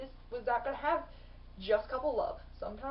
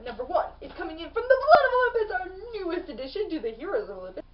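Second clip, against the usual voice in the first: much higher, louder, fast reveal; big announcement; 6 semitones higher, 7 dB louder, faster than usual. Real, not acted. Revealing the top book pick to viewers, hyped. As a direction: announce number one like a show host.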